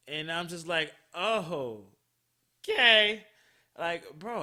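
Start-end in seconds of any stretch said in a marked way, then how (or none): 1.1-3.3 loud, high, thrown-off okay; awkward acceptance. Retold or imitated. Her not knowing how to answer his dirty talk, awkwardly loud. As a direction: jump up in pitch, at a loss. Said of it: "I didn't know what to do".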